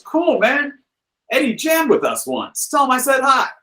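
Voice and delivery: high, bright-voiced casual cool. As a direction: toss it off with a grin, high up. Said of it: "he kinda smiles and goes".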